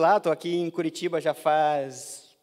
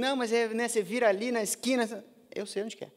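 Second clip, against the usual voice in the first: higher-pitched mock back-and-forth; retold insistence; 7 semitones higher than usual. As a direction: re-enact a polite brush-off, pitched up. Retold back-and-forth about directions.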